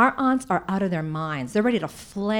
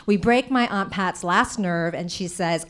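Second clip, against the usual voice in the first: ordinary talk, nothing marked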